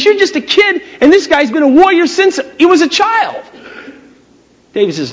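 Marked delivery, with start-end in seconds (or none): 0.0-3.4 high-pitched acted objection; incredulous Saul. Saul warns young David about the warrior, high incredulous protest. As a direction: protest in a raised, put-on voice.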